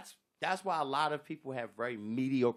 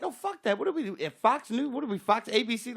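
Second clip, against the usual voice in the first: mocking scoff; 8 semitones higher, 3 dB louder than usual. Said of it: "I'm like".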